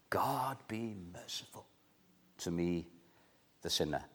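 very soft, pleading murmur to God; hushed sinner's prayer. Retold or imitated. The younger brother begging God for mercy, hushed. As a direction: breathe it out like a humble prayer.

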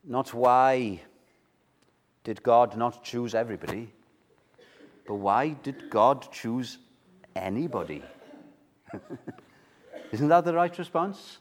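ordinary talk, nothing marked

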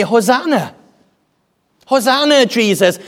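high, ringing cry of praise; crowd's acclamation. Acted. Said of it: "They say".

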